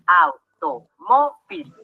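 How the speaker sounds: "Automóvil" muy lento, au, to, mó, vil; separado en sílabas. Shown as a demonstration. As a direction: separa cada sílaba de "Automóvil" con una pausa. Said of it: "Vamos a separarlo en sílabas". ("Automóvil" silabeado despacio y más grave)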